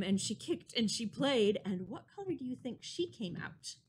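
usual speaking voice

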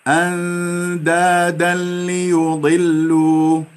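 أندادا ليضلوا with nun dropped into lam; slow recitation. Shown as a demonstration. Teacher shows nun eaten. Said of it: "عند اللام نأكل".

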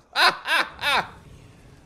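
big high cackle at the subs; loud high laugh. Real, not acted. Streamer laughing at chat's gifts, loud high cackle.

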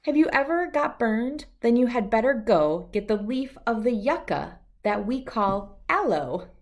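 plain reading voice

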